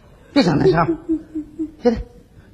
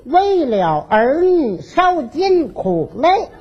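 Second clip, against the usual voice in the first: slow, higher, singsong declaiming; letter recitation; 6 semitones higher, slower than usual. Acted. Father taking his turn reading the children's letter, slow singsong recitation. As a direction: draw each word out like verse.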